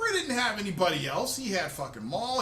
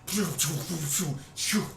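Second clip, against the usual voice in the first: lightsaber swooshes; 4 semitones lower than usual. Retold or imitated. Comedian acting out Luke's green lightsaber fight, swooshing noises. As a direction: mouth sounds of a saber swinging. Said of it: "with his green lightsaber".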